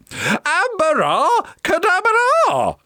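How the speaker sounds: plain reading voice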